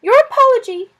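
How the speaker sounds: high, loud, lofty lizard voice; a small lizard standing on ceremony; dignified lizard. Acted. Lizard Suz accepting the apology with dignity. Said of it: "the lizard said with dignity".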